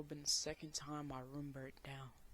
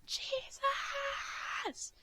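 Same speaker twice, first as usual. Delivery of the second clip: very high, loud desperate scream; top-of-lungs scream of Jesus; 7 dB louder than usual. Retold or imitated. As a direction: cry out his name with everything. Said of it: "at the top of my lungs".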